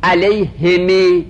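علیهم drawn out very slowly, kasra on the h, mim silent; slow demonstration. Shown as a demonstration. Teacher drawing out the word for students, very slow. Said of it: "کسرها و سکون میم".

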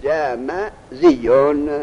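"جمزیون" broken slowly into ja-ma-ziy-yun; derived form shown. Shown as a demonstration. Teacher showing the dropped alef, "جمزیون" syllable by syllable. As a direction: stretch each syllable of "جمزیون", doubling the y.

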